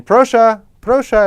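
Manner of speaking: high, loud, urgent "Proszę, proszę" like a deli clerk; mock shop assistant calling the next customer; imitating counter ladies. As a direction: call out like the ladies behind the counter. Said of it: "the ladies all back there saying".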